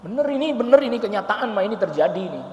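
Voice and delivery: ordinary talk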